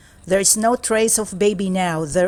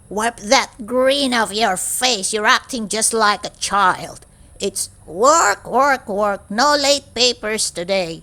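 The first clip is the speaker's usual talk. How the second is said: scolding teacher. Acted. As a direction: scold the grinning class. Strict teacher scolding class.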